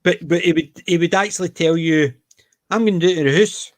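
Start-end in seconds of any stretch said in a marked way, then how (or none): 2.7-3.7 a touch of imitated broad Glasgow accent, "going to" run together as "gonna". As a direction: lightly mimic a Glasgow man heading home. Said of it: "he would do the very broad Scottish accent".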